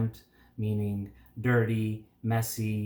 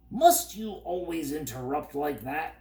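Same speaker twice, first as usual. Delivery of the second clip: irritable; 4 semitones higher than usual. A narrator's reading. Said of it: "said Tock irritably".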